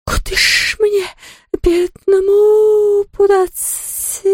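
very high slow sobbing wail; lamenting. Acted. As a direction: wail and sob it out. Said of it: "причитало привидение".

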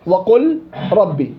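وقل ربي said slowly, merging the lam into the ra; slow demo. Shown as a demonstration. Teacher slowly demonstrates وقل ربي to class. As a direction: drag وقل ربي out so the merge is heard. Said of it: "صغير كبير مطلق".

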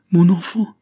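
sudden loud cry of alarm. A narrator's reading. Father cries out to his fainting daughter, loud alarmed cry. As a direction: call out to the child, rising high. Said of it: "s'écria le baron".

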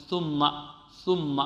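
"ثم" with the th made against the inner upper teeth; tongue behind teeth. Shown as a demonstration. Teacher imitating students' faulty articulation, "ثم" with tongue behind teeth. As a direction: keep the tongue tip inside the teeth on "ثم". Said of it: "طرف اللسان على الصفحة الداخلية".

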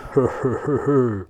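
gespieltes, hämisches Lachen; Räuberlachen. A narrator's reading. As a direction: den Räuber höhnisch lachen lassen. Räuber lacht Großmutter aus. (gespieltes Gelächter des Räubers)

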